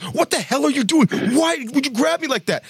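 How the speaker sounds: high, rattled protest at the friend; alarmed demand. Retold or imitated. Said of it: "I'm like".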